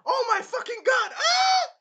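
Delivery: very high, loud shriek; shocked scream. Retold or imitated. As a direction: yell after a hit in the back. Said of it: "Naofumi was like".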